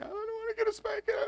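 put-on very high, small child voice; little boy voice. Son answering his father, very high and small. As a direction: whine like a scared kid.

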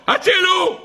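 high-pitched mock scream for help; retold cry for help. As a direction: act out soldiers yelling for rescue in the night. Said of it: "פתאום שמעו צעקות". (צעקת הצלה מוצגת)

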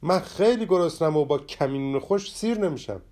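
angry outburst from hunger; angrily. A narrator's reading. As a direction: snap at your friend in hungry anger. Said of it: "با عصبانیت گفت".

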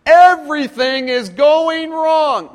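high, loud and slow, everything is going wrong; exaggerated despair, drawn out; overdramatic wail. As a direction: wail it like the world is ending. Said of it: "Have you ever exaggerated something?".

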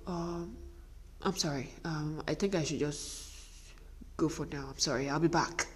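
acted hesitant apology, soft and low; meek apology. Wife answering her screaming husband, meek and hesitant. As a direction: back away with a small, hushed sorry. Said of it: "And you're like".

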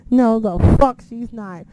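slow, loud, emphatic denial; retold loud disbelief. Reacting to Carmella running down the ramp, loud emphatic disbelief. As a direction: belt out the denial, stretching it. Retelling disbelief, louder second take.

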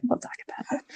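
teasing whisper. Acted. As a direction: whisper it like a secret.

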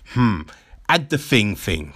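ordinary talk

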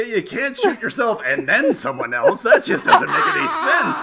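high, loud, laughing mock indignation; laughing outrage. Real, not acted. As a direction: cry out the absurdity while laughing.

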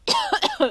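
sudden high spluttering cough; choking cough. Real, not acted. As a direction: cough hard on spit. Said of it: "choking on my own spit".

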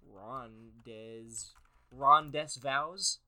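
"rondes vows" sounded out as English; botched "rondes vows"; mangled French. Shown as a demonstration. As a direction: read "rondes vows" letter by letter, puzzled.

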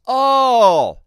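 long, high, loud innocent oh; a sustained oh of innocent correction; drawn-out oh. Retold or imitated. Guide innocently dismisses church.

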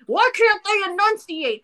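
high-pitched exasperated outburst; exasperated complaint. Retold or imitated. Moviegoer griping at mumbling actors, high exasperated complaint. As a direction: complain in a mock-grandfather huff, pitched right up. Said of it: "I felt like my grandfather".

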